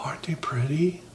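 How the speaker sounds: "aren't they pretty?" whispered soft and unvoiced. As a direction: hush it, barely breathing "pretty". Whispered before the loud take.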